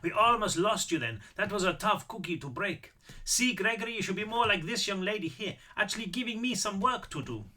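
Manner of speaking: put-on high-pitched old Austrian man; Austrian accent. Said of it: "Apparently I am doing the accent".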